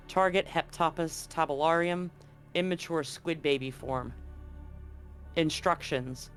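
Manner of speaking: ordinary delivery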